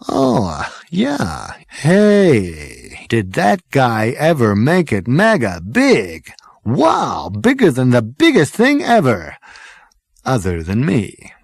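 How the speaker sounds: high, slow, drawn-out thrill; excited Zaphod. Acted. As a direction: gush like a dazzled party boy. Zaphod character voice.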